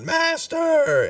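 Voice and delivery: very high, hammed-up scream; screamed cry. Servant flung over the bridge edge, hammed-up scream to his master. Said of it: "as he screams out".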